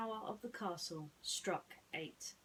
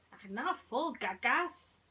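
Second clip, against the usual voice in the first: lightly voiced mouse, a bit higher and louder; Jacques voice; 5 semitones higher, 6 dB louder than usual. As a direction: correct him kindly like a little mouse. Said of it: "he said".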